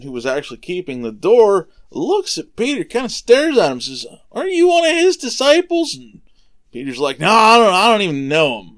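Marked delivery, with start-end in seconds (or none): 4.3-6.0 high, louder questioning voice; acted-out suspicious challenge from the doorkeeper; accusing doorkeeper. Doorkeeper challenges Peter by the fire. 7.2-8.8 loud protest, easing off as it goes; loud mock-indignant brush-off; defensive denial. Peter denies knowing Jesus.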